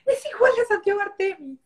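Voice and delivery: high, excited gush; excited squeal. Retold or imitated. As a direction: gush it like a thrilled friend. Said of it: "me dijo".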